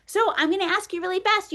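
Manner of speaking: ordinary delivery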